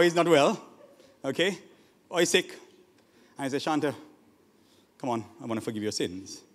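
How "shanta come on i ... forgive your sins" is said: low, soft aside; hushed, lowered pastoral voice; gentle offer. Acted. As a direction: quietly coax someone to accept forgiveness. Acts pastor gently offering forgiveness.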